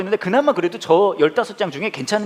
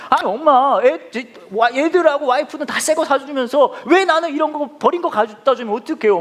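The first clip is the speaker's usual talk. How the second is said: mock complaint; 6 semitones higher than usual. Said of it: "어머니한테 막 따졌죠".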